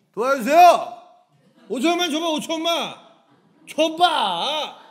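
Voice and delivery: high, mock-demanding shout; loud beggar. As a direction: bellow like a pushy beggar, last word stretched out. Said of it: "거지는 목소리가 커요". (큰 소리로 구걸하는 거지 흉내)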